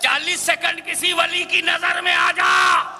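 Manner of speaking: high ringing shout; shouted challenge. Acted. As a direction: hurl it at the crowd. Preacher quoting with full force. (ऊँची आवाज़ में ललकार)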